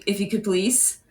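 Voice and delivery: ordinary talk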